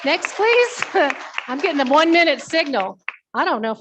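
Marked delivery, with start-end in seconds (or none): none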